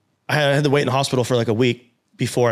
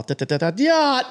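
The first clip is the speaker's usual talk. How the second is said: engine noise jumping much higher, falling off; revving engine imitation; 12 semitones higher than usual. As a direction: rev the engine high and wild. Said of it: "it's all".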